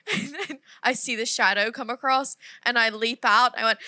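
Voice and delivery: ordinary talk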